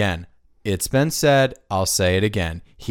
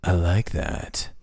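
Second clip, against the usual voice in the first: nineties villain; 6 semitones lower, 3 dB quieter than usual. Retold or imitated. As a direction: menace him quietly, savoring it. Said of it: "they just talk like this".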